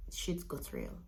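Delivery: slow, hushed and unvoiced; dramatic hush. Real, not acted. Mother admitting things got serious, slow hush.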